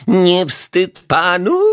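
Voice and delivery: high, loud, slow shaming question; grumbling reproach. A narrator's reading. Neighbour shames the detective, high slow reproach. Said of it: "gderała pani Majewska".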